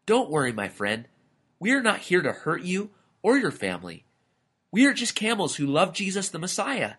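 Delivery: lilting, good-natured camel; friendly camel, reassuring. A narrator's reading. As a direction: reassure the lizard with a smile in the voice.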